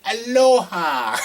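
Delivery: slow, high sung-out Aloha again; drawn-out aloha. Pretend. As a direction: drawl out Aloha warmly. Repeating title playfully.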